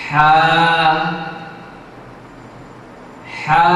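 হা voiced clearly from the middle of the throat; letter demo. Shown as a demonstration. Teacher demonstrates the letter হা for students, deliberate. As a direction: say হা slowly from mid-throat, pausing after each. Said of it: "আমি আবারও আপনাদেরকে দেখিয়ে দিচ্ছি".

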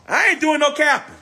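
jumps way up high; high insistent squeal. Real, not acted. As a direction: squeal the denial. Insisting, repeated.

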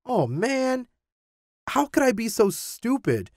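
high-pitched dismay; high, self-reproaching exclamation; dismayed realisation. A narrator's reading. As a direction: kick yourself, voice well up high. Tim realises the misunderstanding.

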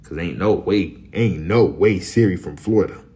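normal talking voice

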